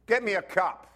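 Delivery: put-on loud, bossy little-kid order; demanding four-year-old. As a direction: play the cheeky kid bossing his dad. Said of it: "four-year-old came up to his dad and said".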